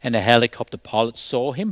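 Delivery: ordinary talk, nothing marked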